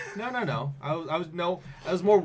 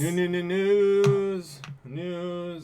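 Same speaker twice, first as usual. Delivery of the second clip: high, loud, very slow call; drawn-out announcer; 7 semitones higher, 8 dB louder, much slower than usual. Acted. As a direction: stretch each word like a jingle. Stretched hammy news call.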